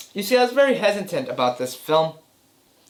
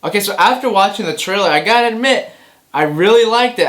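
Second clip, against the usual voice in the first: excited; 8 semitones higher, 10 dB louder than usual. Real, not acted. Enthusiastic start of trailer reaction.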